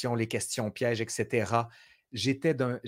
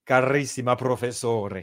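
louder, hammed-up Italian Carissima professore; Italian accent; 5 dB louder than usual. Mocking the Italian colleagues, hammed-up Italian accent. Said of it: "les Italiens quand ils m'écrivent".

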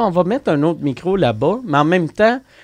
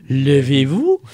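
impression of a host's rising intonation; Claire Lamarche; slower than usual. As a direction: mimic the host, let the end climb. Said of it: "elle a cette intonation-là". (imitation d'animatrice, finale montante)

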